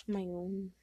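usual speaking voice

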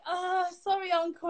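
high-pitched, hesitant mock apology; awkward apology. Niece dodges an uncle's hug, awkwardly apologetic. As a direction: act out politely fending someone off.

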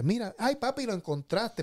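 lightly voiced father, high and slow; imitating his father. Son imitating his father's delighted surprise, high and slow. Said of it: "Y él me decía".

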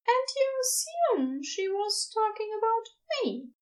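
high, curious character voice; Ezra, questioning. A narrator's reading. Ezra asks whether they are the special somebody, curiously.

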